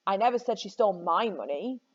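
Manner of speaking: "my" singled out before "money"; stress on MY. Shown as a demonstration. Teacher shows students how meaning shifts, stressing "my". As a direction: lean hard on "my". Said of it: "where on earth you put the emphasis".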